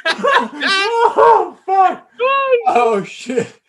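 helpless high laughing cries; laughing till crying. Real, not acted. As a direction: laugh so hard you cry. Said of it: "This guy's literally crying".

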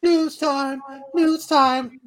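high, loud segment call; segment announcer. Acted. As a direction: call it out like a show jingle.

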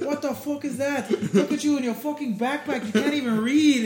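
put-on high, loud Irish-accent heckling; Conor McGregor. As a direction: crow insults in a put-on Irish voice. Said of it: "his accent".

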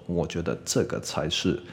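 ordinary talk

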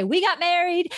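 high, delighted announcement; excited announcement. Acted. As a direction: share the big news with high-pitched glee. Acted excited announcement to friends.

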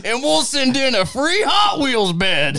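slow, high, shouted sales bonus; home shopping host. Pretend. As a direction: yell the free bonus like an infomercial. Adds to spoof sales pitch.

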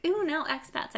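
high, picky "ooh, no"; mock disdain. Voicing her picky past self.

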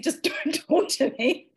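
amused mock insistence; laughing insistence. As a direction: repeat the order, cracking up as you quote it. Repeats retold order, laughing.

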